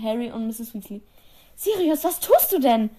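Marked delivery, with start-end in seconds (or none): 1.5-2.9 laut und viel höher, entsetzt; kreischend. A narrator's reading. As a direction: kreisch den Namen erschrocken heraus. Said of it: "kreischte Misses Weasley". (entsetztes Kreischen, hoch und laut)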